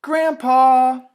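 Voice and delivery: loud, high shout; child shouting for grandpa; calling grandpa. Acted. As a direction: yell across the house for grandpa. Felix calls for his grandfather.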